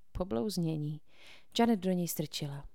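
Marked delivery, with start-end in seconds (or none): none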